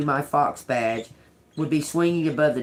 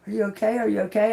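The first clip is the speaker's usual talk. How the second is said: worried; 7 semitones higher, much faster than usual. Retold or imitated. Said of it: "I said".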